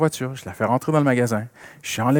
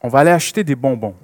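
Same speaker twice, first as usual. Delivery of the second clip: louder, firm fatherly explaining; father to little daughter; 12 dB louder than usual. Retold or imitated. Father explaining responsibility to his little daughter, firm and louder. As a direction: lay out the rule to a four-year-old. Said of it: "je lui dis".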